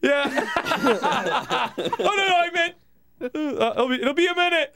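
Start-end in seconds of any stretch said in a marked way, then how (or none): 2.0-4.7 high, flustered mock panic; acted flustered excuse, pitched high; panicked calling out. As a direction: stammer it as if caught behind a door. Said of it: "When anybody shouts from behind a door".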